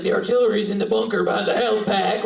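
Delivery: ordinary delivery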